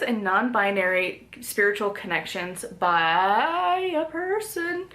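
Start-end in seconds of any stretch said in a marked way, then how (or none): 2.6-4.9 drawn-out words climbing high; hesitant stalling. Real, not acted. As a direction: stretch it out while puzzling over a name. Stalling over a name to pronounce.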